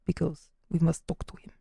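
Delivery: mock Russian accent; lightly put-on Russian accent. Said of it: "maybe I will choose the Russian accent".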